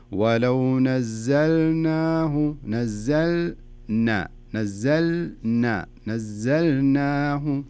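very slow, each syllable of نزلناه drawn out; slow recitation. Shown as a demonstration. Teacher modelling slow recitation for student to copy. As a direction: recite slowly, not rushing. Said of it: "بطريقة بطيئة شوي".

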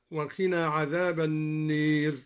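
النير said "an-neer" for an-naar; imala on النير. Shown as a demonstration. Teacher reciting النير as al-Duri does, with imala. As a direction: recite النير with its a bent towards ee. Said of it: "وأمال النار من قوله تعالى".

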